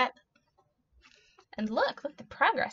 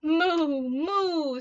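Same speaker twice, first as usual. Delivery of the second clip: drawn-out mooing, higher pitched; cow moo; 5 semitones higher than usual. A narrator's reading. Cow calling to the spider, high imitated moo. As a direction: moo twice like a storybook cow. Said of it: "said the cow".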